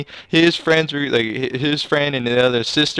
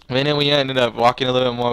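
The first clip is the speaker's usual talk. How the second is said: usual speaking voice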